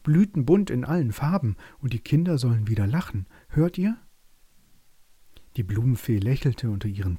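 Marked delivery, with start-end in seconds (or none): 0.0-4.0 high, eager mouse voice; spirited little mouse, higher and insistent; little meadow mouse. A narrator's reading. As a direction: squeak out a plea with hope.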